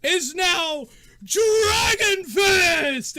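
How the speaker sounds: high, booming, stretched-out name reveal; arena announcer. Acted. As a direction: shout the new ring name to the crowd. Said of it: "and the announcer goes".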